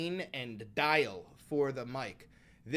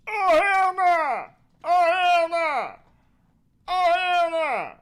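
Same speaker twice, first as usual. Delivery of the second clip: high, loud drawn-out holler; hammed-up yell; 13 semitones higher, 10 dB louder, slower than usual. Streamer reacting to the news, high mock-outraged yell. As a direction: holler it high, falling at the end. Repeated yelled catchphrase reaction.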